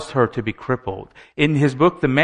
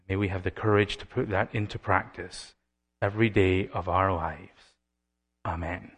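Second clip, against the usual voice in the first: prayer; 4 semitones lower, 8 dB quieter than usual. Acted. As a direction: pray gently to God. Leads the congregation in prayer.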